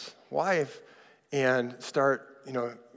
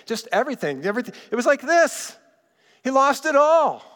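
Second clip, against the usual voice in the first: high, loud exclamation of total loss; emphatic outburst; 10 semitones higher, 6 dB louder than usual. Real, not acted. Preacher telling how a pastor lost it all, emphatic outburst. Preacher dramatizes losing everything.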